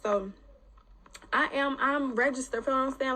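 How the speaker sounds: ordinary delivery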